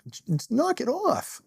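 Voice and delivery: high pitched cop telling his partner off; scolding cop partner. Retold or imitated. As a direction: squeak out a sharp reprimand. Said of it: "his partner turned around and said".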